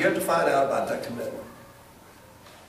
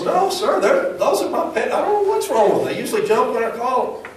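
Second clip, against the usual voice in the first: squeaky high-pitched voice, much higher; high squeaky fisherman; 10 semitones higher than usual. Retold or imitated. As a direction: play the fisherman as a squeaky little man pleading.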